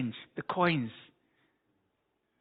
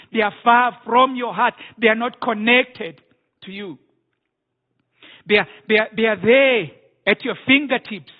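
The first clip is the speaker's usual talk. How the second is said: louder and higher, building to a full-force proclamation; impassioned pulpit voice speaking as God; preaching as God; 4 semitones higher, 4 dB louder than usual. Acted. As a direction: thunder the Lord's complaint from the pulpit. Preaching God's words.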